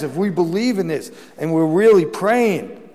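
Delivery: impassioned sermon voice; fiery preaching. Acted. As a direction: drive the doctrine home to the congregation. Preacher building to full force.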